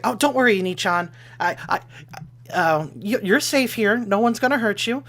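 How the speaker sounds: high-pitched, eager-to-comfort character voice; reassuring Watashi figure. Acted. Watashi picks Scarlet up and promises safety, high and reassuring. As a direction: play a sweet comforter, pitched well up.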